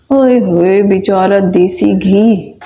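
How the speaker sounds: low, slow comic dirge for the ghee; mock mourning. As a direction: mourn the ghee like a lost friend. (धीमा, नीचा नाटकीय शोक)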